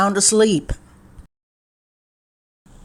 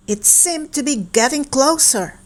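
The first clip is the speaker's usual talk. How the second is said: ordinary delivery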